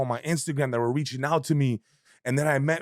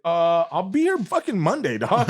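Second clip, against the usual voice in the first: high, louder eager promise; eager excited; 6 semitones higher, 4 dB louder than usual. Retold or imitated. Skit maker eagerly commits to the owner, excited. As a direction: jump at it.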